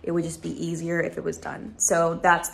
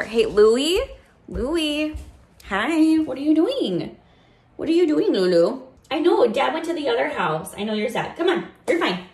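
high, cooing voice for a beloved dog; soothing pet talk; 6 semitones higher, 4 dB louder than usual. Real, not acted. Consoling Louie after Dad went to the other house, high and coaxing. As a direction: comfort a sad pet in a raised, coaxing voice.

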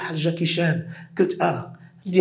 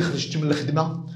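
ordinary delivery

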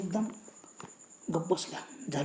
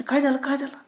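mildly startled, higher-pitched question; 6 semitones higher, 3 dB louder than usual. A narrator's reading. Husband suddenly alarmed in the moving rickshaw, startled. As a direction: jolt into a quick repeated question, pitch lifted. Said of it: "त्यांनी एकदम दचकले".

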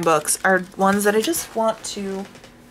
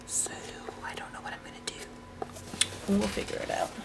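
whisper; 16 dB quieter, much faster than usual. Real, not acted. Whispered aside to camera.

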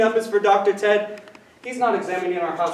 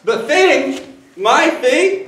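startled disbelief; 8 semitones higher, 5 dB louder, much slower than usual. Acted. Husband startled by doctor news.

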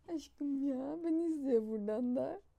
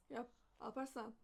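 usual speaking voice

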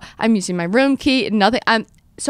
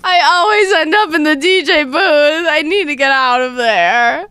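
laughing; 6 semitones higher, 4 dB louder than usual. Real, not acted. Laughing at herself, mock dramatic.

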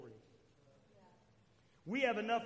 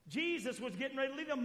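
ordinary talk

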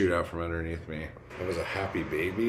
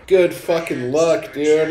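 loud exclamation; 9 semitones higher, 10 dB louder than usual. Real, not acted. Straining at a hard pose.

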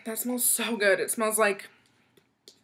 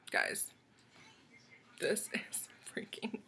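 hushed trailing off; whisper, lost for words; soft whispered awe; awed whisper; 3 semitones lower, 11 dB quieter than usual. Real, not acted.

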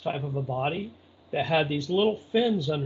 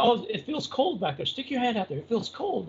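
lightly voiced lady, pitched higher; retold eager urging; 7 semitones higher than usual. Lady coaxing him to feel the cold, lightly imitated.

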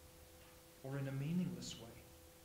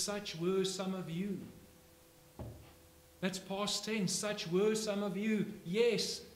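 loud, raised sermon voice; fiery preaching; 6 semitones higher, 7 dB louder, slower than usual. Acted. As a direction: drive the questions home to the congregation. Preaching builds to full force.

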